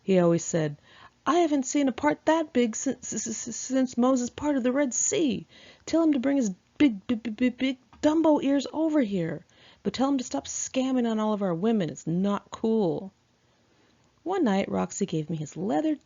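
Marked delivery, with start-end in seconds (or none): 1.2-13.1 put-on light New York accent, higher pitched, stuttering on since and big; a hint of a New York wise guy teasing, tripping over words. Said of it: "a bad stutter and a thick New York accent".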